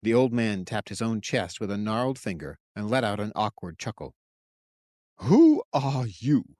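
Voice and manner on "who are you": confrontational. Acted. Monk confronting stranger.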